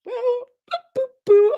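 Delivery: playful high-pitched boo-hooing; mock wail. Mock wail at own mistake.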